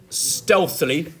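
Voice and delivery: "stealthily" slow and loud, with STEALTH punched; "stealthily" with a heavy first beat and weak "-ily"; stress on first syllable. Shown as a demonstration. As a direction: lean hard on the "stealth" of "stealthily", drawn out. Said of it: "that stressed syllable on stealth".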